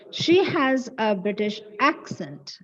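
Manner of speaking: "accent" with AC punched, CENT light; stress on first syllable. Shown as a demonstration. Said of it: "The stress should be on the first syllable".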